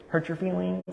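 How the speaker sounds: high teasing question, then a laugh; mocking question. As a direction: scoff at the idea with a laugh. Mocking the idea of hurt.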